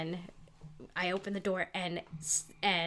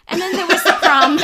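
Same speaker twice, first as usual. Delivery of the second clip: laughing; 12 semitones higher, 13 dB louder than usual. Real, not acted. Starts story while laughing.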